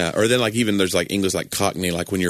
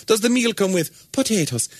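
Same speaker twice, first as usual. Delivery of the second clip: high, lilting Irish "potatoes"; Irish accent; 13 semitones higher than usual. Shown as a demonstration. Irish accent demo over dinner question. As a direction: ask it in a sing-song Irish accent. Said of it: "you just say sentences with the word potatoes".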